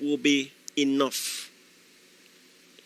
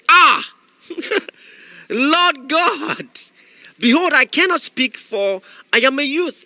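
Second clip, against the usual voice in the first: put-on higher, slow Nigerian-accented wail; 8 semitones higher, 4 dB louder, slower than usual. Jeremiah protesting to God, in a Nigerian accent. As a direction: plead it like a Nigerian youth, Nigerian accent. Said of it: "responded like an African, specifically like a Nigerian".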